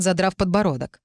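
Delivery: plain reading voice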